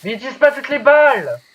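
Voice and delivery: high, exasperated shout; loud protest. Real, not acted. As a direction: protest loudly over the game. Protesting during the zombie fight.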